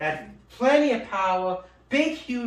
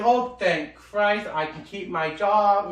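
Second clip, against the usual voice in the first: slow relief; 3 semitones lower, slower than usual. Retold or imitated. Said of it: "Uncle's like".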